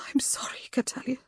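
raw, whispered apology; strained and hoarse, barely voiced. A narrator's reading. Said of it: "her voice raw".

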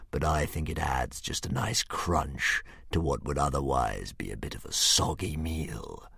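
a confiding sneer from a monster; sneering dragon. A narrator's reading. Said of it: "sneered the dragon confidentially".

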